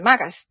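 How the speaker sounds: マーカス punched out loud, long first vowel; loud マーカス rhyming with "circus"; circus-like MARCUS. Shown as a demonstration. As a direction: hit マーカス with circus energy. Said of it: "サーカスみたいなテンションで".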